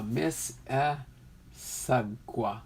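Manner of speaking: "Miss Issaquah" very slowly, syllable by syllable; careful, spaced-out "Miss Issaquah"; broken-down pronunciation. Shown as a demonstration. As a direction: sound out "Miss ... Iss ... a ... quah". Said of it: "break down the pronunciation".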